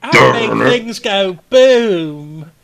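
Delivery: put-on slow, loud American accent. Player boasting about his tank in an American accent. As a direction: boom it out in a big American accent. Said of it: "in an American accent".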